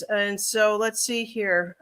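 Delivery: normal talking voice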